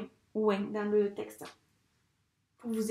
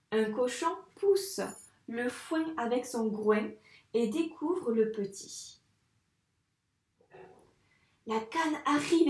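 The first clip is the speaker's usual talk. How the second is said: put-on reading the text with careful intonation for children; expressive read-aloud. Shown as a demonstration. Teacher reading the text aloud to pupils, with expressive intonation. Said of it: "Allez, on y va, vous écoutez bien".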